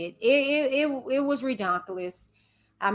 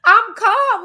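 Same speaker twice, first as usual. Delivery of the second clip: higher and louder protest of calm; insisting calm; 9 semitones higher, 7 dB louder than usual. Real, not acted. Host insisting to viewers she stays calm, loudly. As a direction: laugh it off while shouting calm. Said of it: "I'm loud".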